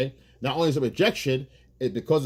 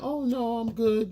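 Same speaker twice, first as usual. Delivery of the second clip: higher, pouty "I'm fine" refusal; mock sulky girlfriend; 9 semitones higher, slower than usual. Girlfriend turning down food to her boyfriend, pouty. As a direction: play her brushing him off, lightly sulking.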